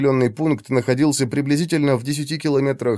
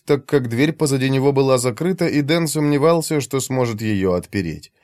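ordinary talk, nothing marked

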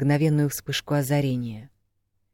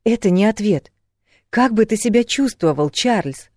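high, loud, exasperated questioning; insistent demand; 7 semitones higher, 6 dB louder than usual. A narrator's reading. Woman unsatisfied by his silence, demanding insistently. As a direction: demand a real answer. She demands an answer, repeating herself.

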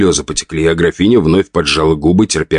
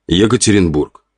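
plain reading voice

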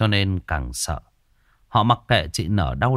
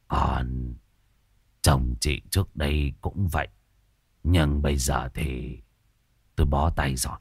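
low, soft, weary explanation; resigned old healer; 7 semitones lower, 4 dB quieter than usual. A narrator's reading. Healer telling the wife he can do nothing, low and weary. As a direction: say it quietly, giving up.

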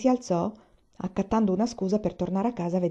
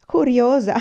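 loud, higher burst of amusement; half choked with laughter; 5 semitones higher, 8 dB louder than usual. A narrator's reading. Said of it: "mezzo soffocato dalle risa". (soffocato dalle risa)

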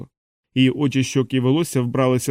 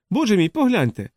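високий вигук, не надто гучний; вигукни високо, кличучи подивитися; мʼякий крик; 8 semitones higher than usual. A narrator's reading. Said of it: "закричали вони". (стриманий високий крик)

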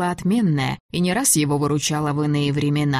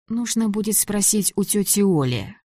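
usual speaking voice

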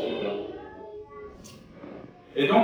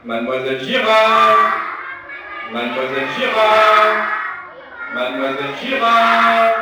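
loud call, rising higher each time; 8 semitones higher, 8 dB louder than usual. Real, not acted. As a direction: call her name across the room. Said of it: "vous allez tous m'aider à l'appeler".

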